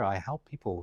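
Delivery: normal talking voice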